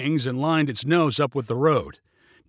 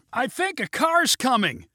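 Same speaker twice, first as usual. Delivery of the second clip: high, strained-up call; shouted warning; 11 semitones higher than usual. A narrator's reading. Passenger warns pilot of traffic, high shout. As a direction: shout it high, volume held back. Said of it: "shouted Neil".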